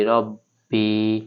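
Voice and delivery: রব্বি with the final ই held one beat; one-alif stretch. Shown as a demonstration. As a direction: lengthen the end of রব্বি. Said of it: "এক আলিফ টেনে পড়তে হবে". (রব্বি-র শেষ ই এক আলিফ টেনে)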